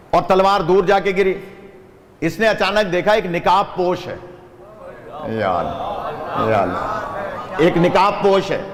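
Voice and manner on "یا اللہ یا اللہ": awed cry. Acted. Awed cry at masked rescuer.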